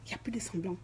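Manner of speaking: whispered aside, no voice; real whisper. Real, not acted. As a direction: breathe it out as a hushed threat. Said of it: "whispered aside".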